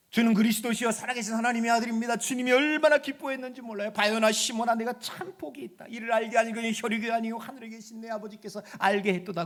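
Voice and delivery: loud, soaring sermon delivery; full-force preaching. Acted. Preacher proclaiming victory over death, loud and soaring.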